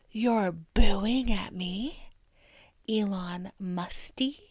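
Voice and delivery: slow, breathless whisper of disbelief; stunned whisper. Acted. Elon on stage after boos, slow stunned whisper. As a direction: whisper it slowly, crushed.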